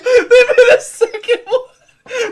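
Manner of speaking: high, slow, laughing echo; laughing mock shock. Echoing his friend's story, laughing mock disbelief. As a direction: repeat it cracking up. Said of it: "echoing friend's shock".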